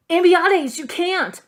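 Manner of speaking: put-on a boy crying out in shock; horrified cry. A narrator's reading. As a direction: gasp it out in horror. Sophos horrified at the kick.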